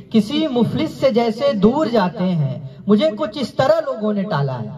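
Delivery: lifted, lilting mushaira verse; poetry recitation. Acted. Recites couplet.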